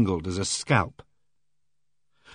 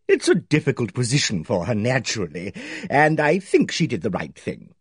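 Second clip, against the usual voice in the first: hearty bossy lady. Acted. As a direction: play her booming and self-assured. Aimee Griffith's hearty voice.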